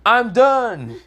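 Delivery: high, loud, cocky boast; smug boast. Acted. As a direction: toss it off like an easy win. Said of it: "I'm gonna say".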